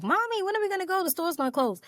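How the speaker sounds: high-pitched imitation of a little girl; whiny daughter. Daughter nagging mom in the parking lot, high whiny imitation. Quotes her impatient young daughter.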